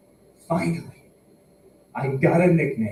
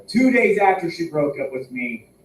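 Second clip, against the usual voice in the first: normal talking voice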